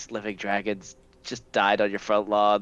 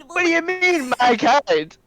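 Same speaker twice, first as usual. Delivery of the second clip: high-pitched indignant question; offended challenge; 13 semitones higher, 5 dB louder than usual. Acted. Slime stung by the owner's remark, offended. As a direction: demand an answer, pitch jumping up. Character offended by your kind.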